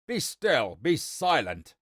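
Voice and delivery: loud, exasperated shriek. Acted. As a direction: shriek at it to stop. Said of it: "shrieked the wizard".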